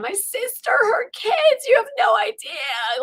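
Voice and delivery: high, tearful retold wail; imitated hysterical pleading; sobbing rant. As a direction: go on and on through sobs. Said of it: "just going on and on".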